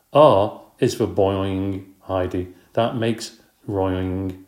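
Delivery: very slow, drawn-out spelling out of a word; slow, teasing spelled-out joke; slow spelling drawl. Acted. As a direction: stretch every word like you're spelling on the board.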